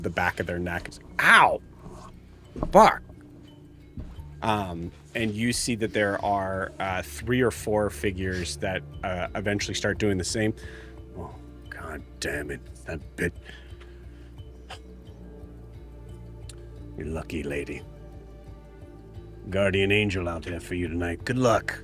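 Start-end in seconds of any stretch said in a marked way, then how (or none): 1.2-3.0 sharp yelp of pain. Acted. Thugs get stung on neck. 11.2-21.8 low grumbling thug. Acted.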